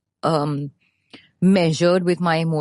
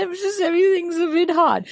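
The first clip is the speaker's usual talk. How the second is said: put-on childish whimper; 10 semitones higher than usual. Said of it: "I said".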